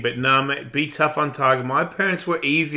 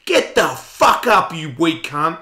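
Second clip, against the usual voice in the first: loud, slow drill-sergeant bark; coach yell; 5 semitones higher, 8 dB louder, slower than usual. Retold or imitated. Trainer yelling at him mid-workout, harsh bark. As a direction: push someone past their limit with a yell. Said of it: "and you go".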